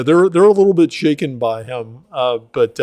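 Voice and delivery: ordinary talk, nothing marked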